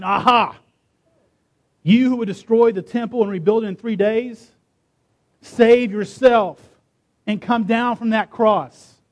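loud, high jeering challenge; derisive taunt. A narrator's reading. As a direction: jeer at him, stretching out the dare. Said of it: "they derided him".

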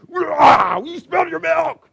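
huge high roaring shout, slowed on the milk; overreacting yell. Parent exploding at kid over spilled milk, roaring. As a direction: blow up like a war just started. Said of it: "the parent just goes off like the World War Two".

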